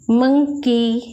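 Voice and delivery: मंकीज with a clear z at the end; model word. Shown as a demonstration. Teacher models मंकीज for the class, clear z. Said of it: "क्या पढ़ेंगे?".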